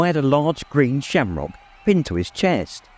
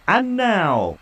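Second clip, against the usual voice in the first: big booming stadium announcer; announcer roar; 8 semitones higher than usual. A narrator's reading. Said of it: "roared Ludo Bagman's voice".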